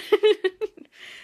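squealing laugh, loud and high; high helpless laugh. Real, not acted. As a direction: burst into a high giggle. Laughing at the lost treats.

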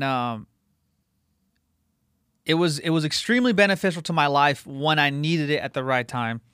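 normal talking voice

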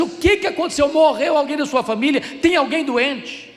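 loud, high, urgent re-enacted questions; alarmed questioning. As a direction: act out rushing in to a friend in distress. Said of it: "eu entrei apressadamente". (perguntas aflitas, encenadas em voz alta e aguda)

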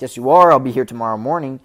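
loud, firm insistence, imitating; loud playful order to a friend; insistent. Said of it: "Ryan Gosling's like".

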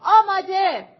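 high, loud call to start; sharp loud "ready" cry; shout. A narrator's reading. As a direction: bark out the ready signal. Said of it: "با فریاد گفت".

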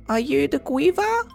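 very high, tiny pixie voice. Acted. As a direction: squeak it out like a small pixie. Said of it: "a tiny voice say".

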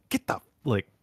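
high, fast angry rant; going off. Retold or imitated. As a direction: tear into him, fast and high. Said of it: "just goes off on him".

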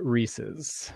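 "Reese's" as REE-siz, short ending; own pronunciation. Shown as a demonstration. Answering the pronunciation question, REE-siz. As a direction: say "Reese's" slowly with a short -iz at the end. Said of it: "I pronounce it".

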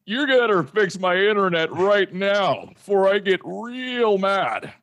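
fake-angry customer demand; mock demanding. Customer threatening internet provider, mock angry. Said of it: "I gotta try that the next time for me".

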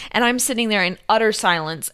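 ordinary delivery

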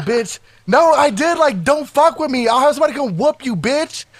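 high-pitched retold warning; heated threat. Threatening a rival girl in a fight, heated retelling. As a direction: warn them off, agitated and up high.

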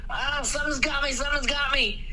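high, laughing cry of being grabbed; hammed-up terror while half laughing; mock panic. Husband being pulled underground.

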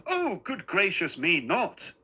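little man's high-pitched protest; shocked cry. A narrator's reading. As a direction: deny it with mild alarm. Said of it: "he cried".

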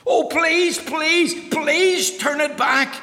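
high loud desperate begging; pleading. Acted. Mister Mean begging the wise man to undo the spell. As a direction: beg frantically to get the gold back. Begging over treasure turned potatoes.

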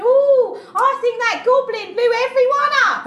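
high, loud mocking snob; hammed-up hoity-toity whine; hoity-toity watchman. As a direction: mimic a pompous guard tattling in a silly high voice. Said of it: "I'm a hoity-toity watchman".

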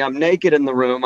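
ordinary delivery

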